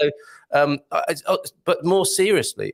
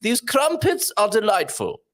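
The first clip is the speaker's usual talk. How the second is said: higher, slow, regal English voice; the Queen; 6 semitones higher, slower than usual. Acted. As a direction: play a monarch pronouncing on tea treats. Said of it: "if it was the Queen saying it".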